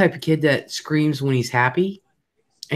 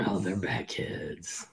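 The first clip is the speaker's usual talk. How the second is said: whisper; 6 semitones lower, 14 dB quieter, slower than usual. Real, not acted. Whispered insistence.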